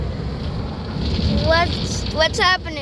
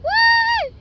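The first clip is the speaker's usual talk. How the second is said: excited squeal. Real, not acted. Excited cheer entering the wash.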